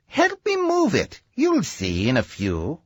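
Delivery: a hint of a confident inventor; Doc Sam's voice. Doctor tells his friend to help move the machine, lightly voiced. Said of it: "He said".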